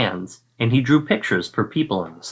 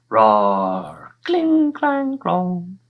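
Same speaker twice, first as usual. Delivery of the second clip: battle noises; 9 semitones higher, much slower than usual. Acted. Narrator acts out dragon and knights clashing.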